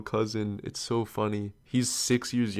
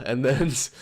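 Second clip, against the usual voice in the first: normal talking voice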